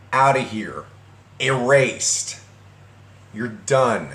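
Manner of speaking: slow, emphatic telling-off; spaced-out verdicts, each one landed hard; laying into her. Retold or imitated. Retelling his message to his ex, scolding firmly. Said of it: "just laying into her".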